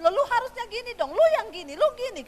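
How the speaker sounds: quarrelling spouse. Acted. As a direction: jab at your spouse in a heated fight. Quarrelling selfish spouses acted. (pasangan egois bertengkar dengan suara tinggi dan keras)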